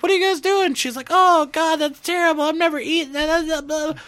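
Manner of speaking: mock grossed-out friend. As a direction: play her up high and squeamish. Friend's reaction quoted mockingly.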